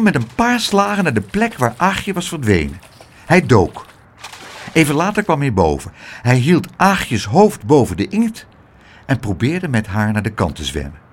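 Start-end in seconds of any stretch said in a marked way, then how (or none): none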